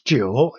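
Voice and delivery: "jiu" going down and going up; third tone. Shown as a demonstration. Teacher showing the third one, "jiu" dipping then rising. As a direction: dip then rise on "jiu". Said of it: "going down and going up on U".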